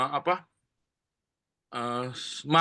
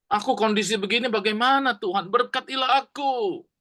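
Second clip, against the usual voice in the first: higher, pleading cry to God; urgent plea; 7 semitones higher than usual. Acted. Jabez pleads urgently to God for a blessing, high and begging. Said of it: "Seperti sangat urgen, mendesak sekali".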